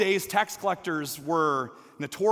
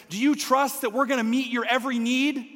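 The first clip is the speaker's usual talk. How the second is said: higher, firm parental lecture; lecturing; 7 semitones higher, 4 dB louder than usual. Retold or imitated. Mother lecturing her son about trust, exasperated. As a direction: drive the point home to your son. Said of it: "as I'm lecturing my son".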